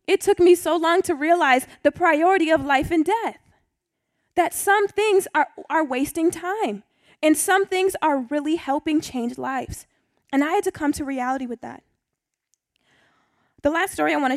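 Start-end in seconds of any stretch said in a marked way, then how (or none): 4.3-9.9 slow, weighty lesson point; slow down and let it land; slow emphasis. Real, not acted. Pastor stressing what matters to listeners, slow and weighty. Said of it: "lesson point delivered slowly".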